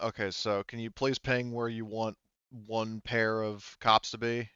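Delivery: ordinary delivery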